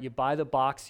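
ordinary delivery

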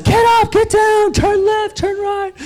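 very high-pitched mock yell; hammed-up shouted commands, strained high; imitated instructor yelling. Spinning instructor yelling orders at riders, mock yelling. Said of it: "Someone just yells at you".

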